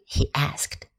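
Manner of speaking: plain reading voice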